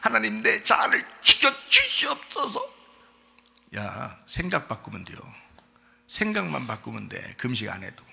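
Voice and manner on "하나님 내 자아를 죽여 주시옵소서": strained high mock plea; agonized fasting prayer. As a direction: imitate a desperate faster begging God. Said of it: "막 금식하면서 막". (금식하며 쥐어짜는 기도 흉내)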